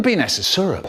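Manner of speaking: usual speaking voice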